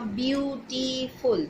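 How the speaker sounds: "beautiful" slowly broken into three syllables; slow, syllable-split "beautiful". Shown as a demonstration. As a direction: draw out "beautiful", one syllable at a time. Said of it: "these are three syllable words".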